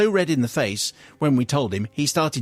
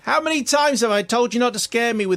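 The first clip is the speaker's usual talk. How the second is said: high-pitched exasperated telling-off; mock shout; 8 semitones higher than usual. Wife shouting at husband about toy snake, high mock shout. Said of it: "I shouted".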